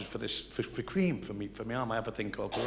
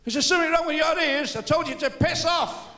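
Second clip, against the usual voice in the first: shouted reply; 7 dB louder than usual. Acted. Unseen reply to the Bismarck, shouting back irritated. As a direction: fire it back, fed up.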